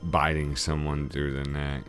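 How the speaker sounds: ordinary delivery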